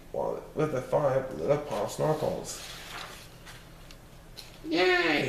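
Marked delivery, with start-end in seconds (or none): 4.7-5.3 put-on high kids' cheer; children cheering. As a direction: cheer like small kids. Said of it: "shouted the five little Popsnuggles".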